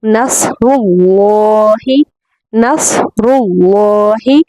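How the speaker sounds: नसरुल्लाहि with a thick heavy र and ल, slow; heavy full lam. Shown as a demonstration. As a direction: fill the mouth on रु and ल्ला. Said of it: "पुर करके पढ़ेंगे".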